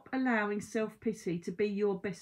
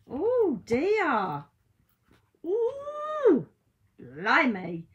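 high, loud cry of dismay; dismayed surprise; 7 semitones higher, 7 dB louder, much slower than usual. Real, not acted. Reader drawing a bad card, loud high dismay.

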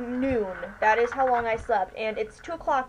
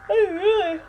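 through a yawn; 6 semitones higher than usual. Real, not acted. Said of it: "I really wish I could stop yawning".

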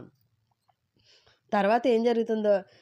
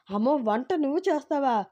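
a startled, fearful cry of dismay, pitched up; frightened exclamation; 7 semitones higher, 3 dB louder than usual. A narrator's reading. Mother-in-law dreading the daughter-in-law's cooking, alarmed. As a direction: gasp it out, alarmed she might cook. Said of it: "ఆ మాటకి భయం వేసి".

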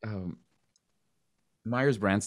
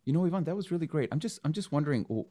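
higher, hesitant, politely puzzled question; puzzled casting director; 9 semitones higher than usual. Retold or imitated. Casting director gently questioning an actor's accent, higher and hesitant. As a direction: imitate a tactful query, voice climbing at the end. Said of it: "She's like".

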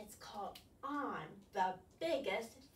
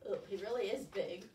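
plain reading voice

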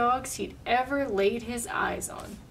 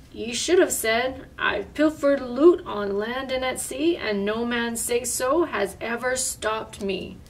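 ordinary delivery, nothing marked

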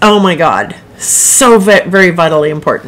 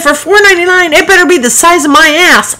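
high-pitched comic outrage; indignant retort; 10 semitones higher, 4 dB louder than usual. Retold or imitated. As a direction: protest the price in a much higher voice. Retells her retort about cookie price.

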